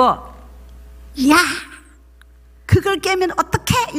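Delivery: mock scold of the son; old habit of yelling, half-acted; imitated angry yell. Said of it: "소리를 치려고".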